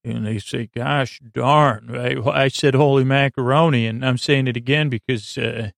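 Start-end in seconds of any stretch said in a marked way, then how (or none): none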